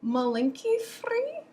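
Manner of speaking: slow, high questioning repeat; imitated Russian clerk checking an order; drawn-out, doubtful question; puzzled counter clerk. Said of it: "the person behind the desk would look at me and be like".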